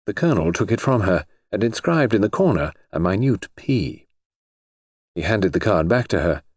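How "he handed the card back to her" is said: ordinary delivery, nothing marked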